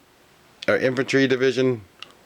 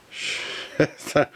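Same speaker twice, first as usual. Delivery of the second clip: ordinary talk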